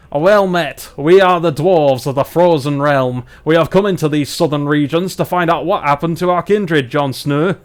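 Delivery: loud, hearty northern English dwarf; hammed-up Barnsley accent, proclaimed loudly. Dwarves greet the travellers and state their quest, Barnsley accent. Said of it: "let's do our dwarves from Barnsley".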